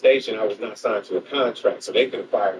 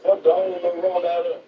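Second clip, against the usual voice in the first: higher, punchy exclamation; mock warning; 10 semitones higher than usual. Warning Craig about ruining the rollout, high and emphatic. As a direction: hit fuck hard like a warning. Same clip repeated.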